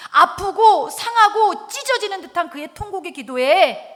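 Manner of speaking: high, loud, anguished proclamation; impassioned preaching. Real, not acted. Preacher describing a torn, wailing prayer, impassioned. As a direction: preach it at full force.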